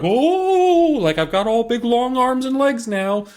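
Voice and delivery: high, drawn-out wobbly "Oh" into mock wonder; cartoon stretchy character. Mimicking kids stretched like Mister Fantastic, high goofy cartoon voice.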